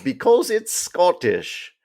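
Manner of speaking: slow, high mock Scottish accent; bad Scottish accent. Host explaining the subtitles to co-host, bad Scottish accent. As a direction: put on a dubious Scottish accent, drawn out. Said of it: "Not Scottish, but about as good".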